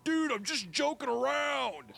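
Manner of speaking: high, slow mocking bully voice; hammed-up mean kid. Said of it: "She's like".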